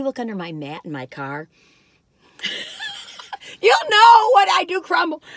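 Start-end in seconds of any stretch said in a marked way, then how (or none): 2.4-3.5 airy squeal of a laugh; high breathy laugh. Real, not acted. Laughing at her own chocolate mess, airy high laugh. 3.6-5.2 high, loud laughing shout. Real, not acted. Admitting her car is full of crumbs, high laughing shout.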